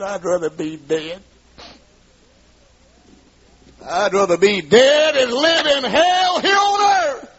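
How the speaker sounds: high, slow anguished cry; despairing cry. Retold or imitated. Wife crying out to husband in despair, high anguished cry. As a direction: cry out that she'd rather die. Wife's despairing outburst retold.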